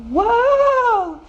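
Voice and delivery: high, drawn-out whoa; startled cry. A narrator's reading. Kitten pulled by a rope, startled cry. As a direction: cry out high as if swept away.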